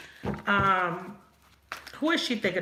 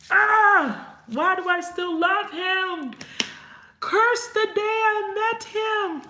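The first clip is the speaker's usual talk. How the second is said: much higher, louder, slow hammed-up wail; mock lovesick lament; 11 semitones higher, 4 dB louder, slower than usual. Voicing Chelsea wailing over the man she loves, hammed up. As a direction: overplay a soap-opera heartbreak.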